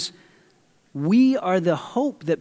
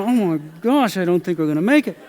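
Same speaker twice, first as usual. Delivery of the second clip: mock panic. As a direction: rush it out in playful fright. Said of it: "I'm looking out there going".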